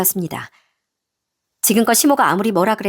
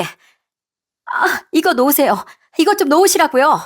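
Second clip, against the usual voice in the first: rising, urgent protest; struggling protest; 6 semitones higher, slower than usual. Acted. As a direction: demand to be let go, more insistently each time. Hair grabbed, demands release.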